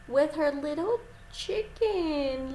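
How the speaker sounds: ordinary talk, nothing marked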